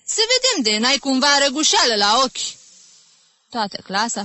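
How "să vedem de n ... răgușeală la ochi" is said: high, raised-voice taunt; sarcastic teacher's jibe; mocking. A narrator's reading. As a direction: tease the faker with mock concern. Teacher mocks the pupil's excuse.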